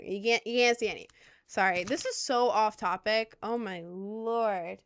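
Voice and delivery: ordinary talk, nothing marked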